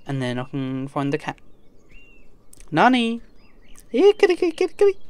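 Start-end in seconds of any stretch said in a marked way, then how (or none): 2.7-3.2 calling out. Real, not acted. Calling for her lost cat. 3.9-5.0 high sing-song kitty call, louder; calling a cat. Real, not acted. Player calling the cat in the game, high coaxing call.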